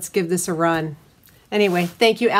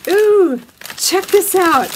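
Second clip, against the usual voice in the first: higher, louder thrilled ooh; excited ooh; 10 semitones higher, 6 dB louder, slower than usual. Real, not acted. Showing viewers the cutter, a high loud thrilled ooh. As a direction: pull the viewers in with delight.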